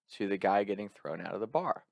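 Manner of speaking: ordinary delivery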